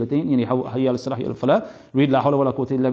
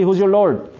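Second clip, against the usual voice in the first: loud, higher demanding question; quick angel question; 5 semitones higher, 5 dB louder, slower than usual. Retold or imitated. Grave angel quickly questioning the dead, loudly. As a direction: fire it off fast like an interrogator. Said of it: "say quickly".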